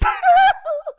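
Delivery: very high-pitched, loud falsetto laughing fit. Retold or imitated. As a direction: crack up in a shrieky imitation falsetto. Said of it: "he just started dying laughing".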